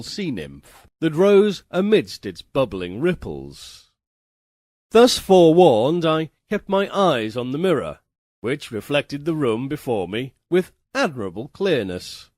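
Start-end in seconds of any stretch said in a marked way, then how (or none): none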